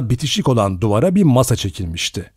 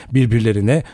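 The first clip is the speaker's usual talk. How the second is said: plain reading voice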